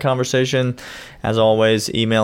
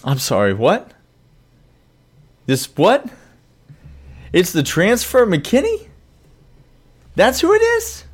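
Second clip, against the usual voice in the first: incredulous questions climbing much higher; mock disbelief; 9 semitones higher than usual. Host stunned by co-host's pick, mock disbelief. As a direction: repeat it back in rising, squeaky disbelief.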